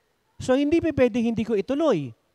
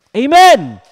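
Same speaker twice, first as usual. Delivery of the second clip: a big ringing Amen, loud; full-voice preacher's Amen; shouted amen; 3 semitones higher, 15 dB louder than usual. Real, not acted. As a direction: call it out to the congregation.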